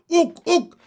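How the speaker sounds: very high, loud froggy hoots; two piercing, excited hoots; gleeful shout. A narrator's reading. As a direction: whoop it like a delighted frog. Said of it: "shouted Pin with glee".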